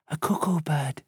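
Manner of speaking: soft, hushed remark; whispered. A narrator's reading. Lisa identifying the forest sound to the others, whispered softly. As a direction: say it under your breath. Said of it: "Lisa whispered".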